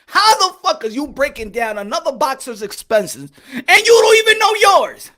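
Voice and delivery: normal talking voice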